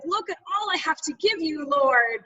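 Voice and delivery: proud Pharisee heart, lightly played; boastful mock prayer. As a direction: show off your gifts to God. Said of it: "coming at it with a heart of".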